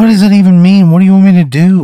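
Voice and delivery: high, loud and frantic; panicked protest. Acted. Reluctant hero protests to the strange townsfolk, high and frantic. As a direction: blurt it out in alarm. Overwhelmed chosen one protests.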